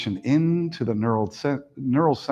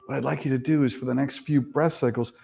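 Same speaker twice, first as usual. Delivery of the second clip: steady, calm breathing cues; guided meditation. Acted. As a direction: lead the breath gently.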